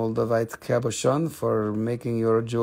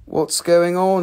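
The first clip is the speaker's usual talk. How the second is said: higher and louder, pushing for a response; host nudging a silent audience, louder; louder repeat; 6 semitones higher, 8 dB louder than usual. Real, not acted. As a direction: call it out to wake the room up. Repeats question to quiet chat.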